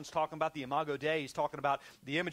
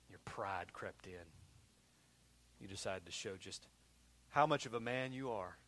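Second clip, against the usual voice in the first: quiet rebuke; 6 semitones lower, 6 dB quieter than usual. Real, not acted. Drops voice for rebuke.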